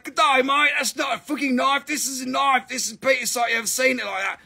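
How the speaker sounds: a hint of an Aussie knife-showoff, Australian; mock Australian tough guy showing off; Aussie bloke. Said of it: "it's like".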